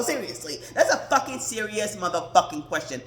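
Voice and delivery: ordinary talk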